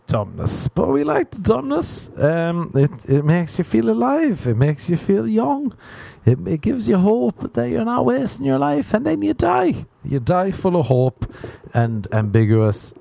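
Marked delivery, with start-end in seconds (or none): 0.7-11.3 much higher, sing-song motivational voice; mock inspirational. Comedian sermonising to listeners about dumbness, mock uplift. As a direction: sell false hope sweetly.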